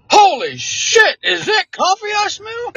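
shocked. Retold or imitated. Recruiters shocked by strong coffee.

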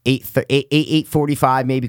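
ordinary talk

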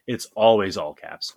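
ordinary talk, nothing marked

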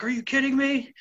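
high-pitched retold disbelief; high, incredulous mock outrage; freaking out. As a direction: imitate a man losing it, pitch shooting up. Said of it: "he starts going nuts".

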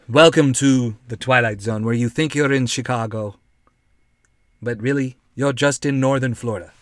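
low, ominous narrator spoof; Twilight Zone host. Acted.

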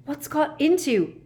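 high, incredulous question; incredulous outburst; exasperated demand. A narrator's reading. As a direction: demand to know, voice leaping up. Harry demands explanation in quarrel.

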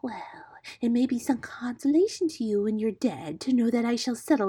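sinister storybook snake; menacing cobra. A narrator's reading. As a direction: threaten the prey with relish. Cobra threatens the lame bird.

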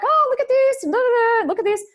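high, loud mock-hysterical squeal; acted-out conniptions. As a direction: squeal in mock panic at everyone around. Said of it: "like, conniptions".

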